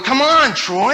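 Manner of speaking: put-on high, loud kid calling his brother; impatient little brother. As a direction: nag him to hurry up like a kid brother. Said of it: "My brother's out there going".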